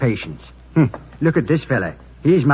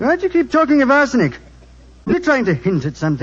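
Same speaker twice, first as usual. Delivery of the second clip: sharp, fast, higher flare of temper; sudden angry suspicion; 9 semitones higher, faster than usual. Acted. Host rounds on the doctor, suspicious of his arsenic questions, angry and fast. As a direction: bristle and fire back quickly. Accused of poisoning hint, snaps back.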